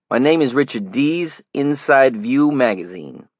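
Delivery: put-on a city stranger greets Johnny, loud Brooklyn accent; flat Brooklyn accent. A narrator's reading. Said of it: "He had a flat city accent as well".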